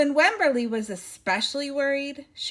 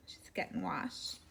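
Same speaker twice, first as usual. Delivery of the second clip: whisper; 6 semitones lower, 11 dB quieter than usual. Acted.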